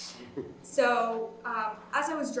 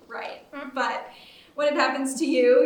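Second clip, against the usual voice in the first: ordinary talk, nothing marked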